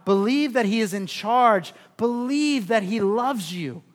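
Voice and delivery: usual speaking voice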